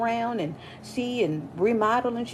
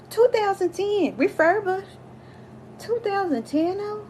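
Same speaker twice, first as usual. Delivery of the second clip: high disbelieving repeat of the year; incredulous; 10 semitones higher than usual. Retold or imitated. Ranting about her boss's old computer, high incredulous squeal.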